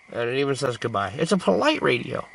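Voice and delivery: normal talking voice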